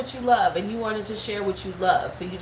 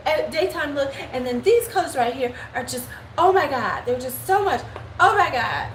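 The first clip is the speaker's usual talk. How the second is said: higher, gushing influencer voice; beauty YouTuber voice; 5 semitones higher than usual. Acted. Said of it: "that's my beauty YouTuber voice".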